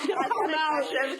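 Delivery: asked through a laugh. Real, not acted. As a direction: giggle while you ask. Player laughing while asking.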